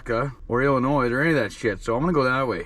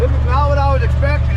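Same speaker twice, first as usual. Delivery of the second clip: amazed; 13 semitones higher, 7 dB louder than usual. Real, not acted. Amazed arrival at the scenery.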